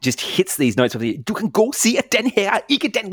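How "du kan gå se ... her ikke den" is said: high-pitched scolding Danish rhythm; Danish telling-off. Shown as a demonstration. Showing Danish's perfect rhythm, scolding in Danish. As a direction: berate someone in mock Danish. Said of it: "rhythm for telling someone off".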